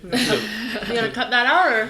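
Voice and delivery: laughing while asking, slowed down. Real, not acted. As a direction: giggle through the question. Laughing at the blooper.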